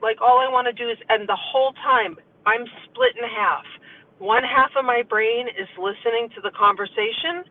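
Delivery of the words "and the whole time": ordinary talk, nothing marked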